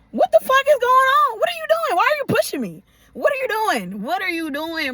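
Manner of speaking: high-pitched, outraged rapid questioning; indignant confrontation. Retold or imitated. Woman retelling how she confronted the man pushing her, indignant. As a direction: demand answers in a high, incredulous voice. Acted confrontation after being pushed.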